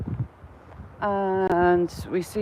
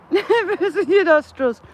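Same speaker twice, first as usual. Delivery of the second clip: laughing; 8 semitones higher, 5 dB louder than usual. Real, not acted. Laughing while reading a chat message.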